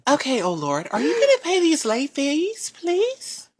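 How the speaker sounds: high, polite collector voice; bill collector. Pretend. Collector calling debtor about late fees, high polite voice. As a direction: raise the pitch on a sweet request. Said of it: "someone was calling you".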